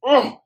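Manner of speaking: short pained grunt; imitating Luffy getting sliced; mock grunt of pain. Luffy hit from behind, mock pained grunt. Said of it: "so he goes like this".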